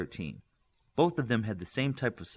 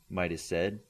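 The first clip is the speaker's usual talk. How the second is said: ordinary delivery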